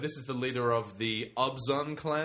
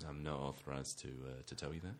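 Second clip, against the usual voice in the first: much lower, much quieter flat clerk mumble; bored store clerk; 10 semitones lower, 14 dB quieter than usual. Acted. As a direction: mumble it like a clerk who doesn't care. Plays deadpan store clerk.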